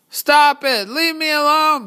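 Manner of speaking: high, loud frightened scream. Acted. Islanders scream in the fog, terrified. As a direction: shriek it out in terror. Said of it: "he started hearing screams".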